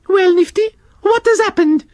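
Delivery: very high, prim scolding snail voice; Shelley the snail scolding the worm and slug, very high prim voice; French snail Shelley. Acted. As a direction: tell off two squabbling children. Shelley asks.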